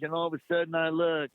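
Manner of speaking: ordinary talk, nothing marked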